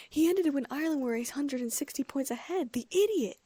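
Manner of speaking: high exasperated rant, peaking on the insult; bellowed complaint. A narrator's reading. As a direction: rail at the player, building to a shout. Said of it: "Ron bellowed".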